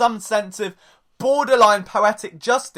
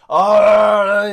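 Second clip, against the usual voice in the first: imitated fuming grunt; 8 dB louder than usual. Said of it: "that he's like".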